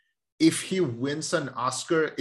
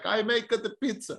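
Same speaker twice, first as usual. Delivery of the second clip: lightly mocking Italian accent, higher; 8 semitones higher than usual. Friend mocks the actress's Italian accent, light mock Italian. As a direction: poke fun at a movie Italian accent. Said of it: "the whole movie she's just like".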